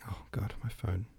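hushed, under the breath; whispering. Real, not acted. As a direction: whisper it in annoyance. Phone interrupts the reading.

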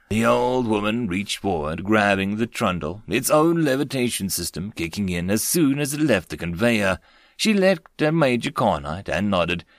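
usual speaking voice

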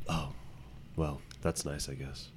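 very soft, deflated imitation; mock disappointed. Cousin imitating relatives hearing baby news, quiet disappointment. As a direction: let it sink away quietly, underwhelmed. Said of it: "just kinda, like, disappointed".

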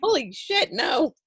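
very high-pitched disbelief; appalled amusement. Real, not acted. Appalled at her idea to jump, high amused disbelief. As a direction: squeak it out, on the edge of laughing.

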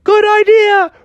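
high cartoon voice. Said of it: "And Taylor was like".

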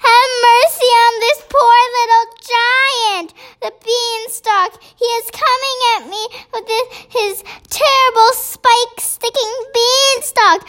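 high-pitched, frantic begging; screamed pleading. A narrator's reading. Giant begs Jack to take the beanstalk away, screaming. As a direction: plead for mercy in a panicked high voice. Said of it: "screamed the flesh lump eater".

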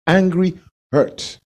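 ordinary talk

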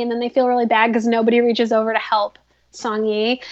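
usual speaking voice

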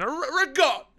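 over-the-top Italian-American food shout; mock Italian exclamation, high and loud; mock Italian accent. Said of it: "not how you say any of that stuff".

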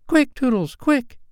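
very high, urgent fairy cry; screaming fairy. A narrator's reading. Fairy urging Tootles to shoot, high screamed cry. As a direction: scream it high to hurry him. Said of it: "she screamed".

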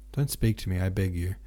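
ordinary delivery, nothing marked

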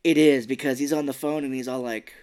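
ordinary talk, nothing marked

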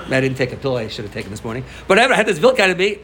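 ordinary delivery

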